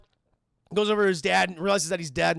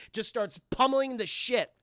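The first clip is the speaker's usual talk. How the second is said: ordinary talk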